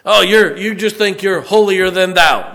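high, loud scornful jeer; sneering accuser. Acted. Townsperson scolding the preacher, loud scornful jeer. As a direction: taunt him as a self-righteous fraud. Mocking townsperson voiced in sermon.

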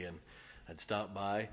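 ordinary talk

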